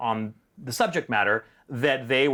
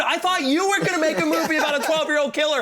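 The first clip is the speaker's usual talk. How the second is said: exasperated retort; 13 semitones higher, 3 dB louder than usual. Retold or imitated. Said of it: "retorting to producer".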